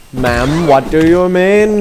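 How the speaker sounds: arguing with the game; indignant. Real, not acted. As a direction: demand an answer in disbelief. Complains to game NPC.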